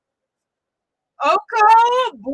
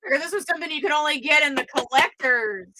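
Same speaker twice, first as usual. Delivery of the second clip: normal talking voice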